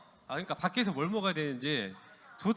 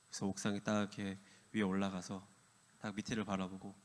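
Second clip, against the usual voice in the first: hushed whisper; 6 semitones lower, 5 dB quieter than usual. A narrator's reading. Tense moment of story.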